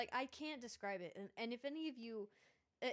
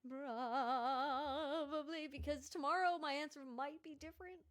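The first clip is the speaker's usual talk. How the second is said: ghost voice; 4 semitones higher than usual. Acted. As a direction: stretch it out like a little ghost. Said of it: "I like your little ghost voice for that".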